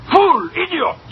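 high-pitched, exasperated name-calling; angry insults. Acted. Boss berates his bookkeeper for gambling with a pauper, angrily. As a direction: fling each insult separately, very high.

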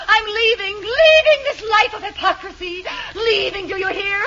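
high, tragic declamation; hammed-up hysterical exit. Wife announces she is leaving, in high mock hysteria. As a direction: build to a hysterical farewell, overdone. Wife's sham dramatic walkout.

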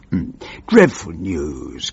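usual speaking voice

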